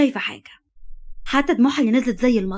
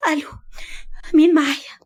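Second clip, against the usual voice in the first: tearful answer; 5 semitones higher than usual. Acted. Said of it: "فردت عليها ببكا".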